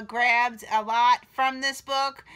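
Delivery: ordinary delivery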